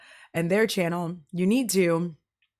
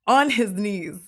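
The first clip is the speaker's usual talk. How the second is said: ordinary talk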